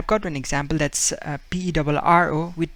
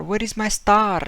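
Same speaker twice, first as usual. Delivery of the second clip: put-on Spanish-accented line; 5 semitones higher than usual. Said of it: "You know".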